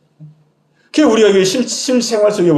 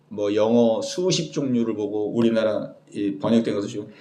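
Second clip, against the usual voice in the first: ordinary talk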